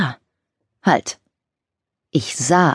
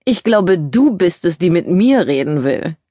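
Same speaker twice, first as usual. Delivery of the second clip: arrogant. Acted. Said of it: "im arroganten Tonfall eines Highschool-Quarterback".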